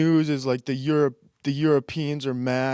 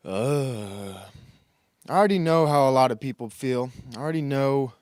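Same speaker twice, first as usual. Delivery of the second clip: normal talking voice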